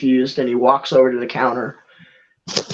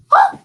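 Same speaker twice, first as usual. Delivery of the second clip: short high startled gasp; 4 dB louder than usual. Acted. Clerk seeing the card, startled gasp. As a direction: gasp in shock, acted. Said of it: "she visibly lets out a gasp".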